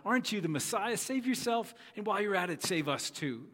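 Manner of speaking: high-pitched, cynical taunt; spitting sarcasm. Acted. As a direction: spit the words out with disgust. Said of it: "Spitting these words out".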